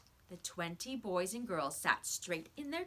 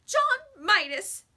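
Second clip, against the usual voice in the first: very high, loud, slow cry; a heartbroken girl shouting at the boy; blurted outburst; 13 semitones higher, 12 dB louder, slower than usual. A narrator's reading. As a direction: burst out in tearful anger. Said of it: "Susan blurted out at last".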